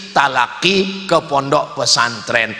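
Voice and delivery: ordinary talk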